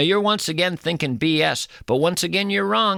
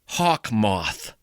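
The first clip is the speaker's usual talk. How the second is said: much lower, slow ominous voice; low spooky intoning; 7 semitones lower than usual. Acted. Host mocking a scary creature name, low and ominous. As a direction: drop into a horror-movie growl.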